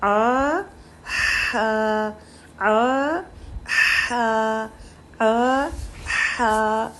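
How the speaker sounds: आ and हा from mid-throat, spaced out; mid-throat drill. Shown as a demonstration. Teacher drilling ain and ha for students. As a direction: alternate आ, हा from the throat centre. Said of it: "तो आइन ज़बर आ".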